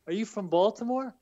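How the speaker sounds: a touch of an Irish lilt, asking a customer; Irish accent. Retold or imitated. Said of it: "In the Irish accent".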